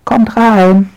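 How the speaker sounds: high, loud call to the others; girl calling her friends into the house. Acted. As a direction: call them all inside. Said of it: "Sie dreht sich um und ruft".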